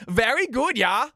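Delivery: high, hammed-up Swedish accent; mock Swedish accent. Streamer answers the chat in a goofy Swedish accent. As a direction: defend it in a silly Swedish accent. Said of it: "his stupid Swedish accent".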